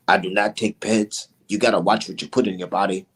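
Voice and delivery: lightly imitating a deep serious athlete; deep-voiced Valdez. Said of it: "with that deep ass fucking voice".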